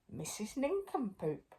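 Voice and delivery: whispered wonder. A narrator's reading. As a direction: breathe the name in a hush. Crowd murmurs the name.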